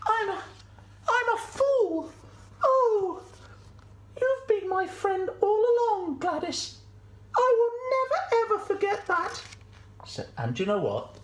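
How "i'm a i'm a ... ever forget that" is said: very high, tearful sheep voice; Sally, remorseful. Acted. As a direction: wail it out as a grateful sheep.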